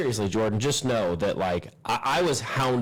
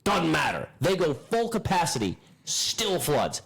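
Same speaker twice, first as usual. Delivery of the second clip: frustrated rant; 7 semitones higher than usual. Real, not acted. Ranting about the sump pumps.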